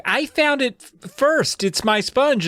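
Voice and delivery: high, whiny little sister voice; bratty kid. Retold or imitated. Kat fights her brother over the sponge, whiny and high. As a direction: squeal it like a kid claiming a toy. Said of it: "she's like".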